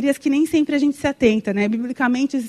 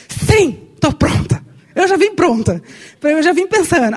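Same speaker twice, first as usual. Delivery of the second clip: higher, louder eager reply; eager yes; 3 semitones higher, 3 dB louder than usual. Retold or imitated. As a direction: jump in, keen and ready. Eager reply when asked to speak.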